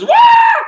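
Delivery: very high, loud comic scream; frightened scream. Acted. Boy learns monsters are in closet, screaming. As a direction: scream out a long wah.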